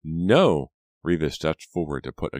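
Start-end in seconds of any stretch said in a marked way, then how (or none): 0.0-0.7 loud, drawn exclamation; acted firm protest; emphatic denial. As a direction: cut off the self-blame. Reva firmly contradicts Pam's self-blame.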